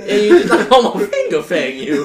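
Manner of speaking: giggling while talking; laughing hard. Real, not acted. Laughing at friend's joke.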